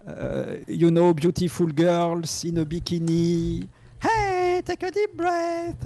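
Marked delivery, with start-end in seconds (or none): 4.0-5.9 put-on very high, drawn-out singsong girly voice; mock meditation girl. Monk mocking American bikini meditation videos, high girly singsong.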